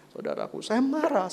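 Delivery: normal talking voice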